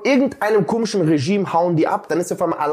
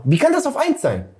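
indignant, incredulous demand; real fed-up outburst; angry ranting; 9 semitones higher than usual. Real, not acted. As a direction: pitch shooting up in outrage. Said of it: "ich bin richtig sauer".

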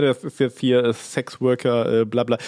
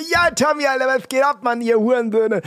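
much higher, cartoonish bro greeting; funny high voice; 11 semitones higher than usual. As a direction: squeak it like a hyped kid. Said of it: "ich benutze immer ganz gerne diese".

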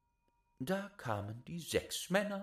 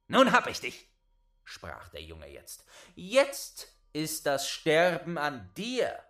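higher and louder, gloating; triumphant gloat; 4 semitones higher, 8 dB louder than usual. Acted. Boy gloats at the giant caught by his beard. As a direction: crow over the trapped giant.

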